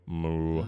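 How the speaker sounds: low, drawn-out moo; long low imitation cow. Shown as a demonstration. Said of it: "you better be mooing".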